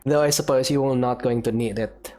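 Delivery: ordinary talk, nothing marked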